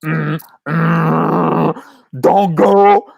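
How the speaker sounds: a gut voice warning, rising to a plea; humming protest building to a loud high don't go; sacral warning voice. Retold or imitated. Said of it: "My sacral was like".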